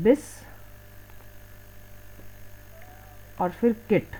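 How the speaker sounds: "बिस", long pause, then "किट"; split into syllables. Shown as a demonstration. Teacher showing how biscuit sounds when spoken, split into syllables. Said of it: "बोलने में कैसा जा रही है".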